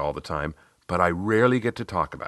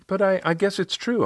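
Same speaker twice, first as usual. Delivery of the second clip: much higher voice of a coaching client; Riley; 12 semitones higher than usual. Retold or imitated. Client reacting to her coach's praise, much higher voice. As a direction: client taking in a new thought, high-pitched. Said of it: "she said".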